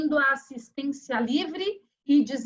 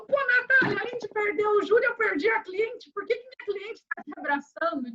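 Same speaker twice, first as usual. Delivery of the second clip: higher, indignant imitation of the lawyer; exasperated defense lawyer; 7 semitones higher than usual. Lawyer complaining that his client hugs the prosecutor, mock indignation. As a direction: play him baffled and upset, pitched up. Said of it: "veio correndo e falou".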